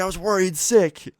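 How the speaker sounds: high-pitched imitated mom outburst; frantic worried mom. Mom to kid just home, frantic with worry. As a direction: exclaim it way up high as an overwrought mother.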